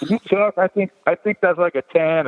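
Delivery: chuckling self-quote in a high voice; laughing through it. Retold or imitated. Him telling staff the wrench size, laughing. Laughing while quoting himself.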